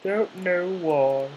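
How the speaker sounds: high, loud, slowly stretched silly voice; silly put-on voice. Real, not acted. Gamer mocking her own weird voice, high and drawn out. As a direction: play up the odd voice on purpose. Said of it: "I don't know why I'm talking like this".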